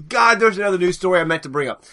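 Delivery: ordinary delivery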